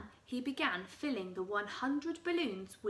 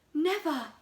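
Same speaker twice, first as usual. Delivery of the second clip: mild cry; 5 semitones higher, 8 dB louder than usual. Acted. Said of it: "she cried".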